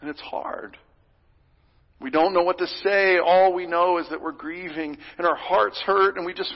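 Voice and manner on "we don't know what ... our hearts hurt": ordinary delivery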